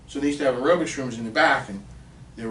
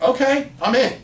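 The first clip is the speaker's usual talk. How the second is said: high, loud burst of retold eagerness; eager acceptance; 11 semitones higher, 9 dB louder than usual. Accepting the scout's offer on the spot, eager. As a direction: jump at the offer, acting it out.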